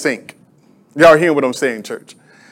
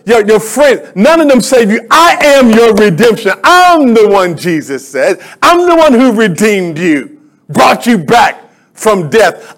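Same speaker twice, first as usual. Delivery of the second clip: emphatic sermon voice, driving each line home; impassioned preaching; 3 semitones higher, slower than usual. Acted. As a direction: preach it with rising conviction. Preaches at full force.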